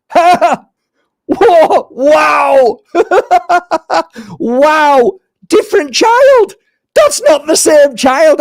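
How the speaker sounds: high, loud, laughing amazement; amazed delight. Retold or imitated. Healer marvelling at the changed child, high and loud. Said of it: "I'm saying in my head".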